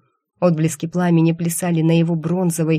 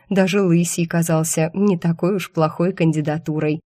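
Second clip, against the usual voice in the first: usual speaking voice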